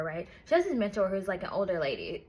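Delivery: ordinary talk, nothing marked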